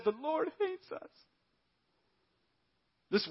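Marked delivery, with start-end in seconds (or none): 0.0-1.1 much higher, softer sulky whine; grumbling whine. Retold or imitated. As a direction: mimic a sulking complainer. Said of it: "you grumbled in your tents and said".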